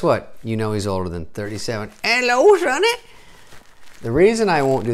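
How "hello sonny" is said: put-on very high, loud old-timer greeting. Comic mocks being older than thirty-seven, old-timer voice. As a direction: pitch it way up as a comic old codger. Plays old man after age joke.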